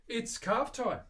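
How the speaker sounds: loud, high-pitched gusto; playful announcement. Pretend. Maker announcing that carving starts, playful and loud.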